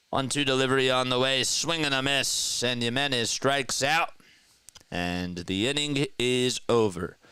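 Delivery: lifted, sustained strikeout call; live play-by-play. Acted. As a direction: carry the pitch through to the inning's end.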